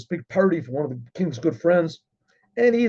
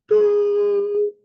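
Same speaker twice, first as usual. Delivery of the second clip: very high sustained horn-like doo; shofar blast; 3 dB louder than usual. Retold or imitated. Shlomo blows the shofar for the king's party, long blast. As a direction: imitate a shofar tekiah with the voice.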